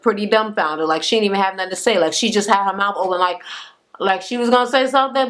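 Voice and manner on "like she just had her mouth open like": usual speaking voice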